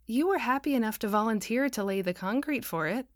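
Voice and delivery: usual speaking voice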